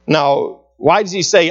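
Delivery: usual speaking voice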